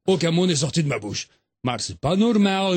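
ordinary talk

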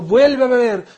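high, loud insistence; urging. Acted. The Prophet urging Abu Huraira to drink again, loud.

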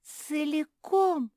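high, loud, drawn-out amazement; incredulous joy. A narrator's reading. Pug asking to eat it all, amazed. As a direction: stretch it out in delighted disbelief.

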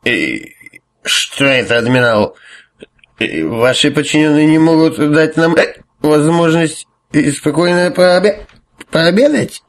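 put-on high, hesitant, slurred drunken whine; drunk annoyance. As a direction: stumble over words, irritated and tipsy. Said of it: "с пьяным раздражением".

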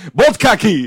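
loud mock Russian accent. Shown as a demonstration. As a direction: bark it in a hammy Russian accent. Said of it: "este es el acento ruso que estoy practicando".

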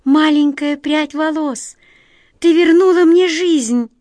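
high, loud rapturous cry; overjoyed. A narrator's reading. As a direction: pour out joy and relief. Prisoner overjoyed at the lock.

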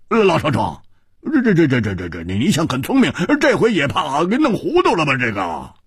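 high, halting voice with a wry laugh in it; wry mumbling old man. A narrator's reading. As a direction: stammer it out like a bemused old man. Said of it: "他苦笑着喃喃地说". (苦笑着喃喃自语的高嗓老人)

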